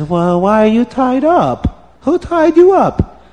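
high, loud, drawn-out questions; astonished woman. A narrator's reading. Farmer's wife finding a man tied up, astonished.

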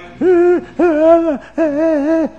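high burst of laughter. Real, not acted. Teller laughs at scared Lavan.

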